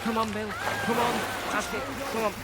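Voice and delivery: high-pitched calling; urgent encouragement. Acted. Friend urging Bill on in the rescue, calling urgently. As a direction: cheer the swimmer on in short bursts.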